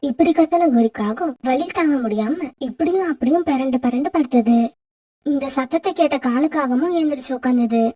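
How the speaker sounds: low, even storyteller's voice; story narration. A narrator's reading. Narrator telling listeners how the crow wept, low storyteller read. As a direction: narrate the sad fable steadily for listeners.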